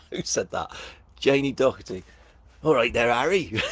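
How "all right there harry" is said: loud, booming greeting in character; hammed-up gamekeeper impression, pitched up; Hagrid. Author mimics the character a viewer compared them to, hearty impression. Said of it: "I sound like Hagrid".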